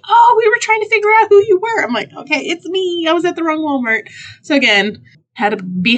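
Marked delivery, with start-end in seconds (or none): 0.1-1.8 very high, loud, quick imitation of an employee; store lady exclaiming. 2.1-4.1 high-pitched mock-sheepish confession; sheepish admission. Retold sheepish admission to the lady.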